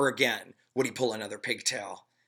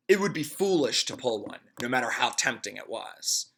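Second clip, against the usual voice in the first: ordinary delivery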